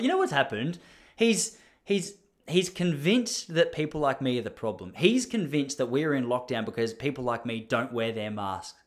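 usual speaking voice

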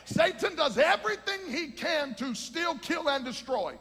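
urgent pulpit exhortation; impassioned preaching. Real, not acted. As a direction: preach it hard to the congregation. Preaches at full force.

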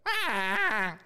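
high, held mock baby wail; drawn-out "ah" like a wailing neonate; imitated baby cry. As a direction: poorly imitate a crying newborn. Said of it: "really poor impression of a neonate".

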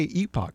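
"epoch" said EE-pock; long-E pronunciation. Shown as a demonstration. Host quoting newspaper staff, "epoch" as EE-pock. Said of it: "will say epoch".

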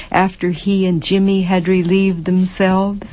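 usual speaking voice

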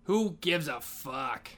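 normal talking voice

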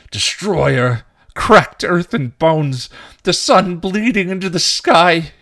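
loud, high, deranged proclamation; crying out. A narrator's reading. Knight lunges at Dawn, crying out madly. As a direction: cry doom like a crazed prophet. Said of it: "he cried".

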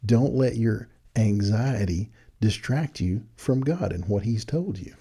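usual speaking voice

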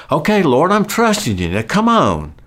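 high, loud, pleading push at the Lord; impatient prayer. Retold or imitated. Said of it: "I remember praying and thinking".

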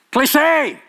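a scornful verdict thrown at the crowd. Real, not acted. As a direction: slam the word down.

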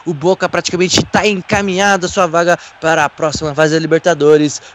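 ordinary talk, nothing marked

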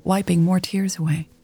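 ordinary delivery

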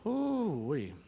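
wincing ooh-wee at the memory; rueful ooh-wee. Real, not acted. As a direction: let out a pained whistle of disbelief. Reacting to bad news.

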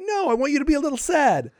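mock whiny lieutenant. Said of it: "lieutenants who are like".

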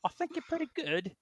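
high, lilting mock Irish singing voice; Irish accent. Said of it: "that's my Irish voice".

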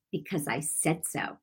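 a soft, stubborn mock reply; spirit's defiant murmur. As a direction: let the spirit answer under its breath. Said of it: "Just like that".

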